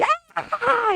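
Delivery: put-on high-pitched child's shriek; grito infantil. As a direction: imitate a small boy's wordless scream. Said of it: "Eran unos gritos". (chillido agudo de niño)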